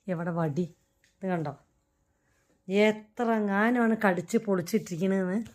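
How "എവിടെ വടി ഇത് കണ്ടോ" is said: softly, under the breath, എവിടെ വടി? low and hushed; quiet threat. Real, not acted. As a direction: mutter the threat to yourself. Threatening pet, later shouted. (പതുക്കെ ഭീഷണി)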